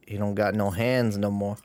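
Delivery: normal talking voice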